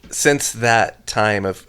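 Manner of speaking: usual speaking voice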